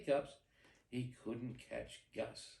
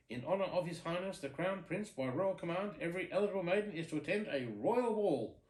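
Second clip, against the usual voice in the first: loud, higher herald reading the decree; royal proclamation; 5 semitones higher, 4 dB louder than usual. Acted. Messenger reads royal proclamation.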